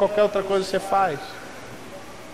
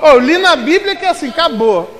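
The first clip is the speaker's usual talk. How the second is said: mock naive enthusiasm; 7 semitones higher, 5 dB louder than usual. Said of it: "Não é aquele negócio".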